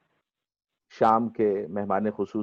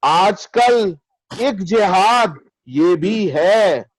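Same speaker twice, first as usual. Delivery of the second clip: measured, higher mushaira declamation with lengthened vowels; ghazal recitation; 7 semitones higher, 5 dB louder, much slower than usual. Acted. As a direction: recite the verse grandly, drawing out each phrase.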